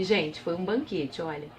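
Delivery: plain reading voice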